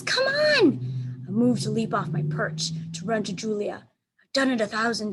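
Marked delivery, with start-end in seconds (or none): 0.0-1.2 very high, loud call. A narrator's reading. Julia calling Bob in the storm, high loud shout. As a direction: shout for the dog across the storm.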